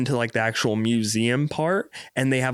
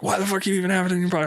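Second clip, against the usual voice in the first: put-on exasperated girlfriend; 8 semitones higher, much faster than usual. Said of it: "Abby was like".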